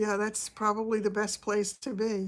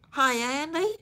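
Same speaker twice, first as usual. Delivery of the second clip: higher, twangy Southern "Hi, Andy" with a rise; Gomer Pyle impression; 6 semitones higher than usual. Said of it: "replicate Gomer Pyle's accent".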